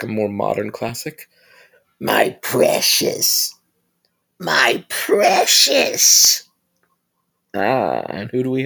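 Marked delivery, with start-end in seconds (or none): 2.0-3.5 slow, whispered creature croon; Gollum hiss. Retold or imitated. Vlogger imitating the creature hissing over its ring, slow whisper. 4.4-6.4 much higher, louder, stretched creature cry; Gollum shriek. Retold or imitated. Vlogger imitating the creature crying over its ring, high and slow.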